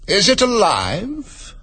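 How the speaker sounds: high, very slow question rising at the end; high-pitched, lingering unicorn voice; curious unicorn. A narrator's reading. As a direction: draw it out high, like a marvelling creature.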